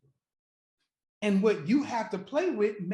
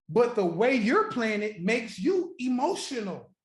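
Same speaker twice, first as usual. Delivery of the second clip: ordinary talk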